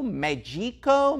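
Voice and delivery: "Mexico" slow, high, as "Medjico"; unsure Mexico. Shown as a demonstration. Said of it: "not knowing how to pronounce".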